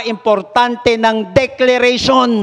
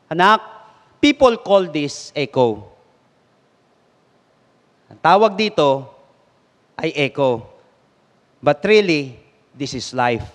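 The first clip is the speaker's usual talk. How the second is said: gentle father; 6 semitones lower than usual. Acted.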